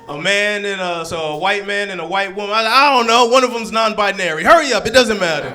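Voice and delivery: flustered, high, loud caller; escalating mock panic on the phone; Karen voice. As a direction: shout impatiently for the police to hurry. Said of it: "this is my Karen voice".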